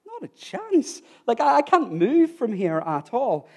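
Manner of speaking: very high, alarmed protest; retold panicked refusal. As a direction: act out a frightened, stubborn refusal. Terrified climber refusing in retold exchange.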